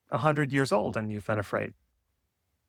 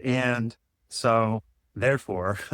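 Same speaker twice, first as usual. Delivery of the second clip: slow; much slower than usual. Pretend. Mock grand summary, slowed.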